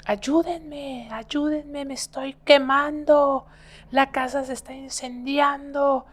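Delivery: high-pitched imitation of a panicked grandmother; hammed-up screams of a burning old woman; retold frantic cries. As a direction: cry for help high, stretching the words. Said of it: "empezó a gritar".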